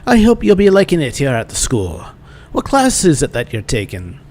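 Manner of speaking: badly faked Irish lilt; bad Irish accent. Instructor asking a student about classes, bad Irish accent. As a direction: put on a cheery-fake Irish brogue. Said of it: "It's the worst Irish accent ever".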